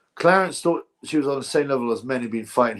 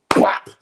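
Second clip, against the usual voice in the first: a loud punch sound effect; mock punch noise; 5 dB louder than usual. Mimicking the fighter's punch landing, a loud bwap. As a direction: smack the word out like a punch. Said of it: "And he goes up and goes".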